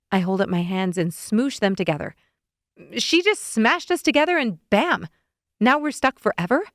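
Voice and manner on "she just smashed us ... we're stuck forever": exasperated. Acted. Narrator exasperated, high loud.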